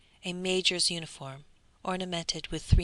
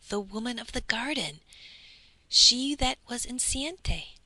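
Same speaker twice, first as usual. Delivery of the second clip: alarmed recall; 6 semitones higher, 4 dB louder than usual. A narrator's reading. Bertuccio to the count, frightened and urgent. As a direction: blurt it out as if seeing a ghost.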